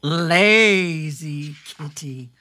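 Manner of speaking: slow drawn-out reading; storybook reading. Acted. Host reading the picture book page, slow. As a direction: read it slow for the kids.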